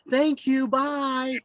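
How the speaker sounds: high, bright quick goodbye; chirpy thank you. Retold or imitated. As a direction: mimic her sudden cheer. Cheerful woman leaving.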